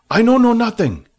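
very high, loud denial; Fat denying. A narrator's reading. Fat questioned about the dragon, high loud denial. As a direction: deny it too eagerly.

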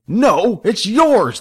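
high-pitched angry comeback; screamed retort. A narrator's reading. Fighting brother throws the blame back, screaming. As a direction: yell the blame straight back. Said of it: "the other screamed back".

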